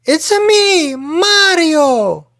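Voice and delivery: very high, loud cartoon plumber voice; Mario impression. Podcaster delivering a favorite game quote, high loud Mario impression. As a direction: belt out a squeaky catchphrase, stretching the name. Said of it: "my favorite quote from a video game".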